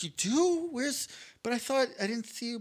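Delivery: a high, imitated girlish "You do?"; surprised girl. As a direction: mimic her disbelief lightly. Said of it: "she's like".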